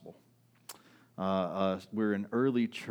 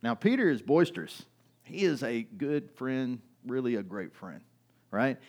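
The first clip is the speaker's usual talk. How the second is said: ordinary delivery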